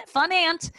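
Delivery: high chirpy fun aunt voice; chirpy cover-up voice; fake cheerful aunt. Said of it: "calmly just got up and was like".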